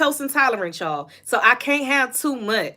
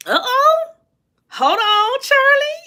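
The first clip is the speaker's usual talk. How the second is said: much higher and louder, a gossipy alarm; surprised uh-oh; 7 dB louder, slower than usual. Real, not acted. Streamer reacts to viewer Charlie's question with high surprised alarm. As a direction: perk up like you just spotted tea.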